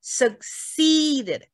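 succeeded with a long, stressed SEE; succeeded as suk-SEEEE-ded, slowly; stress demonstration. Shown as a demonstration. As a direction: lean hard on the second syllable of succeeded. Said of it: "重音在哪一节？".

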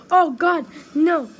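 loud high panicked cry; terrified horror victim. Real, not acted. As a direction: shriek as he catches up. Monster reaching him.

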